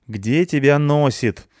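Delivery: slow, high, annoyed demand; irritated drawn-out complaint; displeased. Acted. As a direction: scold him for being late. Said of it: "спросила она недовольно".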